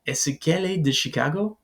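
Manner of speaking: "Est-ce qu'elle est de Chicago" climbing high on "Chicago"; rising question intonation. Shown as a demonstration. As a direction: ask "Est-ce qu'elle est de Chicago" with the voice lifted at the end. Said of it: "your voice will probably end up rising".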